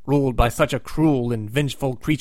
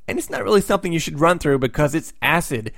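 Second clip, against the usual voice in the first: ordinary talk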